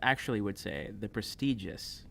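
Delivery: usual speaking voice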